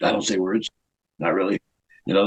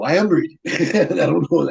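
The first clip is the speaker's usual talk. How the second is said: higher, laughing mock protest; amused retort; 4 semitones higher than usual. Wrestler answering the director about reading, laughing. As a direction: protest it, then break into laughter.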